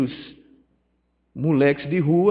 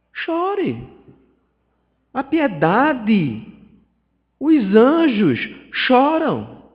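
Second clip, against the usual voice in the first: fervent preaching; 5 semitones higher, 5 dB louder, much slower than usual. Acted. Preaching emotional climax.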